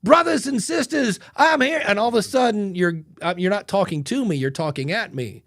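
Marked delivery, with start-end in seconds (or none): 0.0-1.8 much higher and louder pulpit call; mock preacher. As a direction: sound like a preacher opening a sermon. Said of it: "you know who else talks like this".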